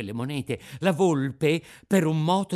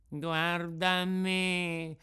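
very slow and mournful, a touch higher; the sly fox, drawing out a sad lament; the fox pleading in a slow, put-on sorrowful voice; 4 semitones higher, much slower than usual. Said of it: "disse la volpe".

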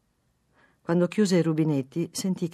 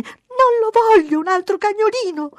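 very high, loud wail; child's shout; 10 dB louder than usual. A narrator's reading. Daughter clinging to papà's leg, shouting refusal. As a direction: scream it like an upset little girl. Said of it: "gridò la bambina".

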